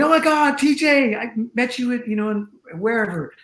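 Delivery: high, loud gushing fan greeting; excited fan. Retold or imitated. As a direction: burst out like a starstruck fan spotting his hero. Fan excitedly recognizes him.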